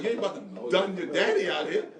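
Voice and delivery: laughing challenge. Retold or imitated. Dad laughingly telling his son he won't beat him. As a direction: laugh while daring the kid. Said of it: "I'm like".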